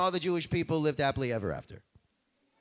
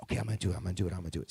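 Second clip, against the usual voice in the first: sinking ever lower, from low to very low and softer; reluctant agreement; 12 semitones lower, 6 dB quieter, much faster than usual. Acted. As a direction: talk yourself into it, sinking lower each time.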